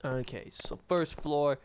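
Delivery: usual speaking voice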